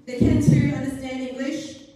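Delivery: put-on shrill, furious child yelling back. A narrator's reading. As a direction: shout it back in a rage. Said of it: "I yelled at him".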